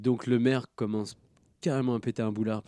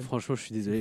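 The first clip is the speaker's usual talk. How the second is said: usual speaking voice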